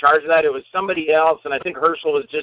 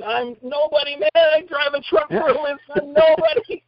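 much higher, incredulous protest; can't believe the fuss; disbelieving self; 9 semitones higher than usual. Retold or imitated. Self-deprecating inner thought.

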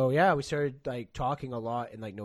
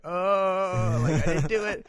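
high, louder mock panic; deer in the headlights; 7 semitones higher, 8 dB louder, slower than usual. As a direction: squeak it out like a caught guy. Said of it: "like a deer in the headlights".

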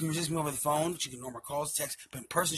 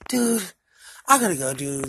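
loud, pitched-up "dude" of impatience; imitating exasperated girlfriend; 3 semitones higher, 8 dB louder than usual. Girlfriend cutting off the call, imitated exasperation. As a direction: act out her fed-up brush-off, loudly. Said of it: "She gets all crazy like that?".

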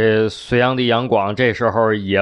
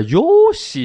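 very high, exaggerated Japanese-sounding exclamation; mock Japanese; 4 dB louder than usual. Listener questioning the odd phrase, in high mock Japanese. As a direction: leap up in pitch like pretend Japanese. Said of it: "这不是日本话吗".

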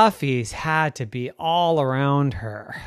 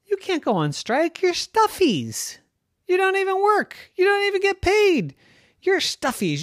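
put-on high, bossy little-girl voice; a girl scolding her toys, outraged; indignant little girl. Becky répond aux peluches.

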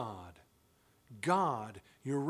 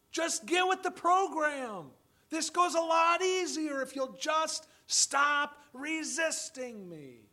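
much higher, louder, very slow stern scolding; exasperated father; 10 semitones higher, 5 dB louder, slower than usual. Retold or imitated. Father scolding his defiant children, high, loud and slow. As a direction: lay down the law to a defiant child. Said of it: "I sometimes want to say to them".

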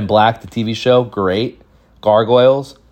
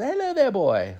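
high, softer greeting; genie-like grandpa; 6 dB quieter than usual. Retold or imitated. Grandpa greets a boy without asking his name, high soft genie voice. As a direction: imitate a fairy-like hello, high and light. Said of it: "like, a fairy or, like, like the genie".

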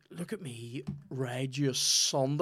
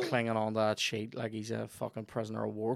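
ordinary talk

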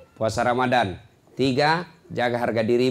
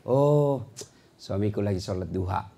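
calm trusting wife; 6 semitones lower, 3 dB quieter than usual. Acted.

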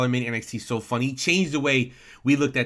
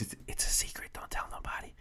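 hushed stage whisper of a secret; 13 dB quieter than usual. Pretend. Jokey secret aside to listeners.

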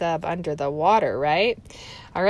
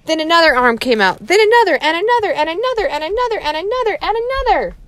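rising much higher and louder with each another; building suspense; 10 semitones higher, 6 dB louder, much faster than usual. A narrator's reading. Teacher reading to kids, building dread over the arms. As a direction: pile on the tension, faster and higher.

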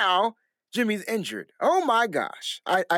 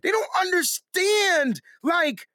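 worked up, higher and fast; heated rant; 10 semitones higher than usual. Real, not acted. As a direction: rant like a frustrated fan. Heated rant about team.

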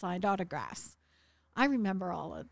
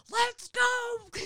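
loud, high drill-sergeant bark; imitated army-man yell; 13 semitones higher, 8 dB louder than usual. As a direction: shout it in their face like a drill sergeant. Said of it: "total drill sergeant".

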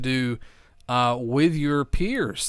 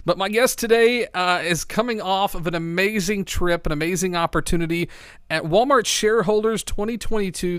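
usual speaking voice

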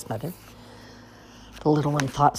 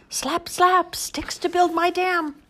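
high slow busy beaver; slower than usual. Acted.

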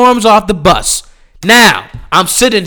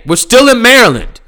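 ordinary talk

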